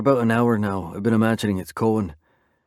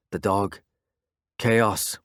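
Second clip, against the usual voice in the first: ordinary delivery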